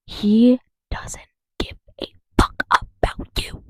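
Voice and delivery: slow whisper, word by word; emphatic whisper. Real, not acted. Spelling it out for Trump voters, emphatic whisper.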